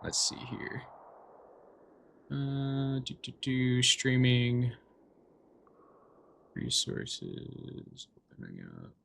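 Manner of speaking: very soft, absent-minded murmur; muttering to self. Real, not acted. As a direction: murmur to yourself while busy setting up. Muttering to self while setting up.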